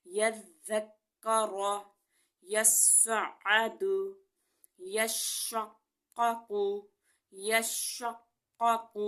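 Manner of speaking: very slow drill, doubled letters held; tajweed recitation. Shown as a demonstration. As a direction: hold each shadda slowly. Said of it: "recites shadda practice words". (شد کے ساتھ آہستہ تلاوت)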